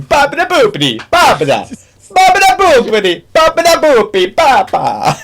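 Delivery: very high, loud fake Italian babble; sing-song Italian nonsense like a tourist; mock Italian gibberish. Imitating tourists babbling in a restaurant, very high.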